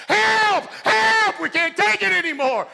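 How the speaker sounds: high, loud cries for help; screaming for help. Retold or imitated. All six men in the dark screaming, high and loud. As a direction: yell help with everything you have. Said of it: "cheering at the top of our lungs".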